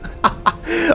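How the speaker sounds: high unvoiced giggle; breathy giggle at himself. Real, not acted. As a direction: laugh at your own silly voice. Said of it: "That's ridiculous".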